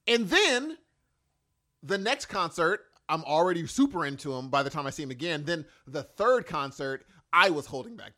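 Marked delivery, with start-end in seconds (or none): none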